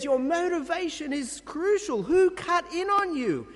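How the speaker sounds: high, loud, indignant outburst as Paul; fuming Paul. Retold or imitated. Preacher voicing Paul rebuking the Galatians, high and loud. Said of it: "is fuming".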